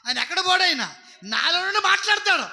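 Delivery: loud, high-pitched preacher's cry to the congregation; fiery preaching. Real, not acted. Preacher exhorting his congregation, shouting loud and high. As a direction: drive the message home at full force.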